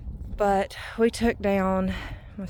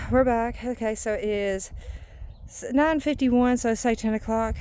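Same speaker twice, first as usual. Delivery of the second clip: ordinary talk, nothing marked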